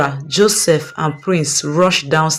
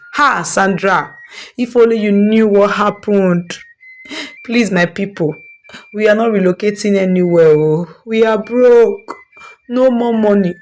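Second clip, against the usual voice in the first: dramatic lament; 3 semitones higher than usual. Acted. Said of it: "sighed dramatically".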